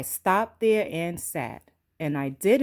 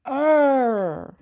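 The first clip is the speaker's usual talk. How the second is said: startled grunt; 8 semitones higher, 6 dB louder than usual. Acted. Startled woman's noise.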